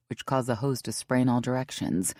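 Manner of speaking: ordinary delivery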